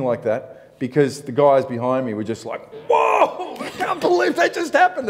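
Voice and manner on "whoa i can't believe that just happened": much higher, laughing amazement; amazed whoa. Retold or imitated. Surfers behind him after the dolphins, higher and laughing. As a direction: gasp it out in laughing disbelief.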